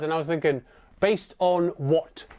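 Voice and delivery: usual speaking voice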